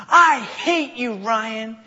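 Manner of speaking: high, loud, drawn-out outburst; mock angry little sister. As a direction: imitate a furious kid lashing out at a brother.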